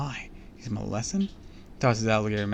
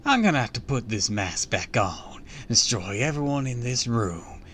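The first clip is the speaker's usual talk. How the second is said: ordinary delivery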